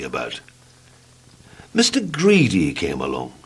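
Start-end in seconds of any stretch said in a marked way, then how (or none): none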